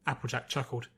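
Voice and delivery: plain reading voice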